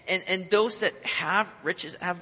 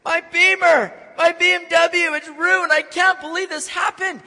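loud, high-pitched freak-out in a mock rant; whining, yelling, complaining; 11 semitones higher, 9 dB louder than usual. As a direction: yell it like an outraged man acting out a meltdown. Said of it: "He's whining, and he's yelling and complaining".

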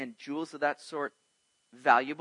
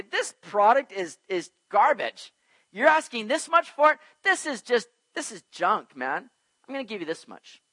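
haggling buyer; 5 semitones higher, 6 dB louder than usual. Retold or imitated. Buyer scoffing at the seller's price, a mock haggle. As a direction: knock the product down.

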